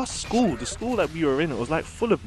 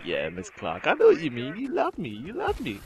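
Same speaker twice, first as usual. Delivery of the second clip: smug inner voice; 7 semitones higher than usual. Retold or imitated. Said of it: "I used to think".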